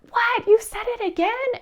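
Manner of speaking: very high, loud, playful disbelief; mock astonishment. Mother reacting to son's okay, high excited mock surprise. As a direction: squeal in delighted amazement at a child. Mother acting amazed once more.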